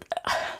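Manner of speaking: soft, drawn sigh; reluctant sigh. Real, not acted. Streamer sighs before reading the song part, reluctant sigh. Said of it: "I'm not excited about it".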